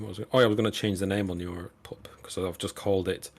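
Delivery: quick unvoiced aside; whisper. Real, not acted. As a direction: whisper it fast to yourself.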